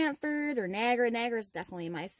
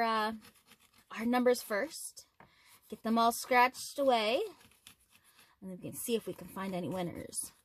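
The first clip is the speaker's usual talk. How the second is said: ordinary delivery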